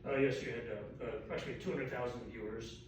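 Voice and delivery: lightly voiced soft-spoken friend; imitating Rabiel. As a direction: murmur the stats shyly. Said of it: "He goes".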